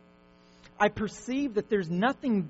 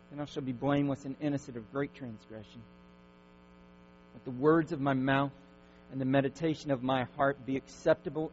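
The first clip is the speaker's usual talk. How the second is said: hushed prayer; 7 semitones lower than usual. Acted. Pastor closing the psalm as a prayer, hushed and reverent.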